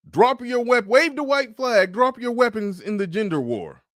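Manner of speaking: high-pitched imitation of a command to disarm; mock surrender order. General telling the other side to drop their weapons, mock command. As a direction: call out a mock order to lay down arms.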